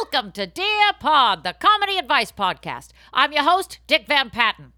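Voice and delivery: loud cheesy podcast host open; announcer intro. Acted. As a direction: boom it out like a show host.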